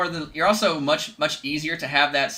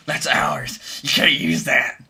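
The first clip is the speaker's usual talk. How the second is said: gremlin voice; 5 dB louder, much slower than usual. Retold or imitated. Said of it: "that's what a copyright company sounds like".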